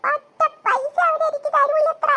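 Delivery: slow, loud, high-pitched bawl; wailing. Acted. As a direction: drag the grievance out in mock sobs. Boy wails about getting no money.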